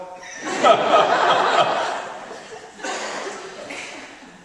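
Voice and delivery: a long high breathy laugh; real hearty laugh at own joke; real laughter. Real, not acted. Audience-facing laugh after punchline.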